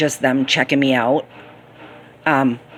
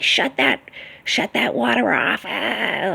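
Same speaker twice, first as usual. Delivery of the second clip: mock yelling; 6 semitones higher, 5 dB quieter, slower than usual. Former worker imitating her boss at the kids, mock yelling. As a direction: imitate a scolding yell without real volume. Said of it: "I literally heard her yelling".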